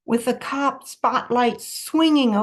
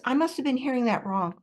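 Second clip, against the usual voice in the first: plain reading voice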